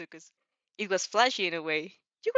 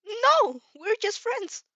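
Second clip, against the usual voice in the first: flustered denial; 6 dB louder, much slower than usual. Acted.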